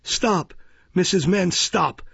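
high, commanding interruption; show of authority. A narrator's reading. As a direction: halt her with official self-importance. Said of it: "with a show of authority".

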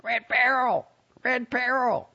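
high squawking parrot cry; shouting parrot. A narrator's reading. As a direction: squawk it like a bird. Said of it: "shouted out".

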